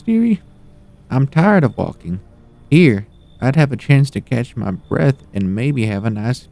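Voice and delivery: put-on high old lady voice. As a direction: play a sweet meddling granny.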